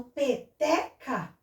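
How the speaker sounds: "peteca" slowly, stretching TE; "pe-TE-ca" with the middle syllable held; strong syllable shown. Shown as a demonstration. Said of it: "a sílaba mais forte".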